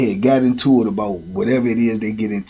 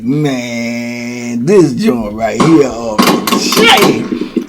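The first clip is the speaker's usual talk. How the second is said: amazed; 3 semitones lower, much slower than usual. Retold or imitated. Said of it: "And I'm like".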